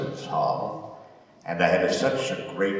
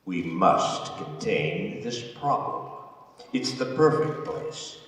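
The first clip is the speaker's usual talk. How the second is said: measured, weighty order building to a louder address; commanding vizier; 3 semitones higher, 6 dB louder, much slower than usual. Acted. Vizier singles out the warden, commanding. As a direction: press each phrase deliberately. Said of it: "Akasi said".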